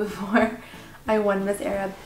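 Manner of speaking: ordinary delivery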